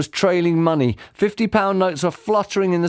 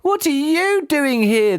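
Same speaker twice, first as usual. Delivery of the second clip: put-on slow, high child's voice, demanding; a boy firing the question back; 13 semitones higher, slower than usual. A narrator's reading. Son throws the same question back at dad, high and slow. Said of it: "the boy demanded".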